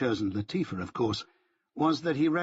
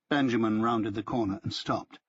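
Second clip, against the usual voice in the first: usual speaking voice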